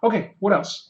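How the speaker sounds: usual speaking voice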